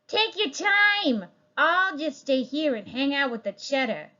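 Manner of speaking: rat staying behind with the cheese; sly rat. Acted.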